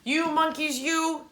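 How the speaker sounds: a mild peddler telling off monkeys; scolding peddler. Acted. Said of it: "he said, shaking a finger".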